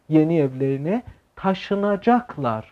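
slow, deliberate reading of yeni evlerine taşınacaklar. Shown as a demonstration. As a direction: lay out the sentence slowly for learners. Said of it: "به جای taşıyacaklar میگه taşınacaklar". (خواندن آهسته جمله)

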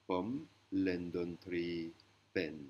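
"Pom lendon tri pen" spoken very slowly; slow Thai sentence. Shown as a demonstration. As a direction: lay out "Pom lendon tri pen" one word at a time. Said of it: "Qui veut dire".

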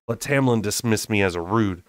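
usual speaking voice

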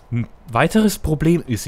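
usual speaking voice

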